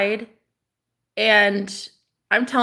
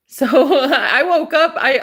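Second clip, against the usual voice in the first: laughing through the words, higher and louder; giggling real laugh; 6 semitones higher, 6 dB louder than usual. Real, not acted. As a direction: crack up as you start the sentence. Laughs at own story.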